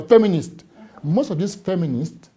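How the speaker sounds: ordinary delivery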